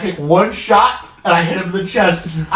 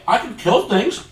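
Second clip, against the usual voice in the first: ordinary talk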